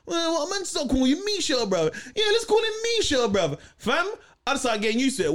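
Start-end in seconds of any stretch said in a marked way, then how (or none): 0.0-3.6 put-on high, teasing older-boy voice; mocking olders. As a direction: taunt the little kid, high and cocky. Olders taunting the kid.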